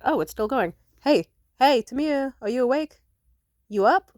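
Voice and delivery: calling out loud and high to wake someone. Retold or imitated. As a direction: shout down the phone to rouse her. Boyfriend calling to wake her.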